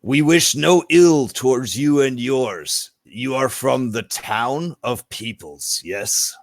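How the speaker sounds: formal, deliberate creature voice; stately reptile offering peace; courteous lizard folk. Acted. Lizard folk assures outsiders of no ill will, measured and courteous.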